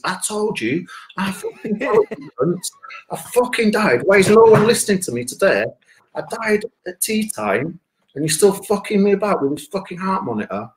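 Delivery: high-pitched mock indignation; protesting to nurses. Dead man telling the nurses off, high mock outrage. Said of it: "I'm saying to the nurses".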